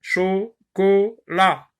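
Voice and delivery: "Chocolat" stretched slowly as cho-co-la; slow syllables. Shown as a demonstration. Teacher counting syllables for pupils. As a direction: drag out "Chocolat" beat by beat. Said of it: "il y en a trois".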